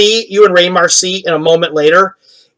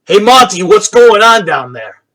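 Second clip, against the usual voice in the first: raised, higher-pitched call down a hallway; 5 semitones higher, 4 dB louder than usual. Acted. Man calls to Monty down the hall, calling out. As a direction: call to someone at a distance.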